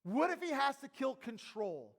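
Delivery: emphatic sermon voice, pushed out loud; full-force preaching. Real, not acted. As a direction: press the challenge hard on the congregation.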